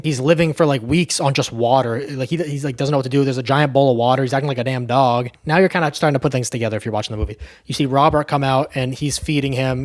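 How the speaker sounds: normal talking voice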